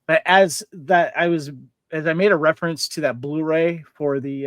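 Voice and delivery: normal talking voice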